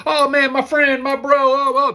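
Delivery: high, loud gushing buddy act; mock chummy salesman greeting; super friendly. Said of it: "Not as super friendly".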